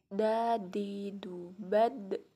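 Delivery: "Da, di, du, bad" with the final d bounced off the gums; "bad" ending in an echoing d; bouncing Arabic d. Shown as a demonstration. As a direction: tap the tongue on the upper gums and let "bad" rebound. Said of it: "harus terdengar mantul".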